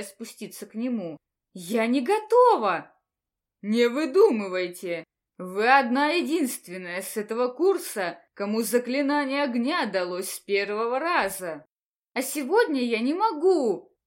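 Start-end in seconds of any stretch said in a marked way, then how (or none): 1.5-2.9 panicked protest. Acted. Student panics before teacher. 3.6-11.6 stern scolding. Acted. Teacher scolds student. 12.1-14.0 loud pleading protest. Acted. Student pleads with teacher.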